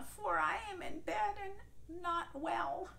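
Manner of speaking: weak granny calling from bed; frail grandma. Acted. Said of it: "called Grandma".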